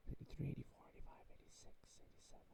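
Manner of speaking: soft unvoiced whisper counting years. Real, not acted. Vlogger counting back the years to herself, whispered. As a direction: count it under your breath, to yourself.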